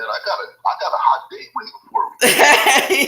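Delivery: words breaking into high laughter; laughing through it. Real, not acted. Husband laughing to host about a hot date with his wife. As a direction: giggle the line out, barely voiced.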